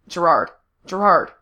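loud, falling calls; calling his name. A narrator's reading. Rescuer calls his laughing friend back, insistent. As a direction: call out to get his attention. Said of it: "Frank was calling his name".

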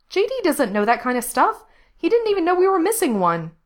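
high, emphatic, defensive answer; exasperated retort. A narrator's reading. Diana answers Frank about the missing kid, exasperated. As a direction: push the pitch up in frustration.

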